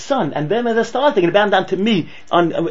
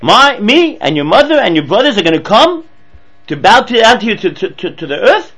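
indignant father; 2 semitones higher, 6 dB louder than usual. Retold or imitated. Yaakov voiced rebuking Yosef.

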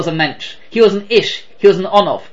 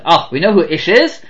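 ordinary talk, nothing marked

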